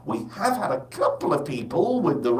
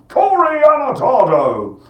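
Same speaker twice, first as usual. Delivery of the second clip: louder, higher, stretched out; announcer call-out; 9 semitones higher, 8 dB louder than usual. Real, not acted. Presenter introduces game show fan Corey, game show shout.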